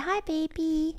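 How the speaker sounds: put-on soft high baby greeting; tender. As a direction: coo it gently. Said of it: "I said".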